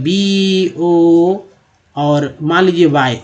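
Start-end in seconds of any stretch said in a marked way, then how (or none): 0.0-1.4 बी ओ as drawn-out "beee, ohhh"; spelling out. Shown as a demonstration. Teacher questions reading it this way, drawing out the letters. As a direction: stretch each letter name in बी ओ slowly. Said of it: "ऐसे पढ़ते हैं".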